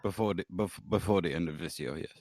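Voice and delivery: low, halting character answer; put-on impaired-speech voice. Comedian confirms his prediction, put-on speech-impediment voice. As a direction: stammer it out low with soft R's.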